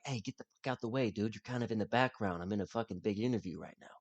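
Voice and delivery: hushed, under his breath; quietly telling him to move; muttered aside. Retold or imitated. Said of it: "trying to get him to".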